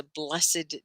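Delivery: BLESSED as "bless-id"; two syllables. Shown as a demonstration. Teacher gives "blessed day" as an example, two syllables. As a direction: give BLESSED a separate second syllable. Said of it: "I think blessed could be an adjective".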